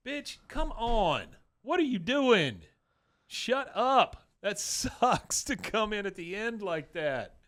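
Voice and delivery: high-pitched, drawn-out indignation; comic outraged complaint; exasperated rant. Real, not acted. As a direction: scold her in high, stretched-out words. Host rants at the character Peggy.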